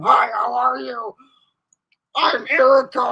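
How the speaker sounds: high, loud playful introduction; hammed-up Erica saying hi; mock Erica intro. Sibling Erica introducing herself to guys, hammed-up and loud. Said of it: "what you're gonna say to them".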